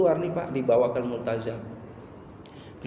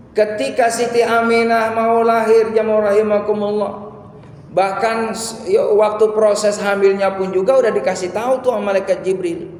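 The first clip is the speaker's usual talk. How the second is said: high, loud sermon storytelling; urgent, raised pulpit voice; impassioned preaching; 9 semitones higher, 6 dB louder than usual. Real, not acted. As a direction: preach the climax at full force, pitched up. Preaches at full force.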